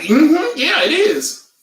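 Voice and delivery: high, loud eager agreement; mock a gushing yes-man; imitating him. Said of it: "And he's like".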